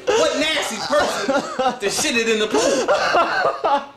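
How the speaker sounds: high, slow, fake-outraged shout; mock outrage.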